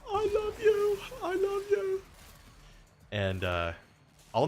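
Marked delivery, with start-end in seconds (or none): 0.1-2.0 very high, tearful child imitation; crying kid. Young fan bursting into tears, imitated high crying voice. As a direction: wail it like an overwhelmed little boy. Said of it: "he just outburst in crying".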